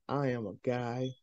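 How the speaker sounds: low, soft, put-upon reply; mock deadpan correction. Caller mistaken for ma'am, mock deadpan correction. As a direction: drop it low and quiet to prove the point.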